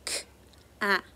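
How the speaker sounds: sounds said apart, k then at; segmented sounds. Shown as a demonstration. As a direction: split cat into k and at. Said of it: "we can clap our hands to blend".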